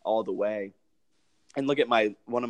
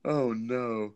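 mock dismay; 7 dB louder, much slower than usual. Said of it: "I was like".

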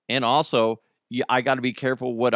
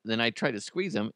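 ordinary talk, nothing marked

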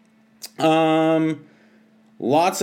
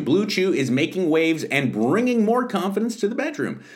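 ordinary talk

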